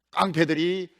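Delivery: ordinary talk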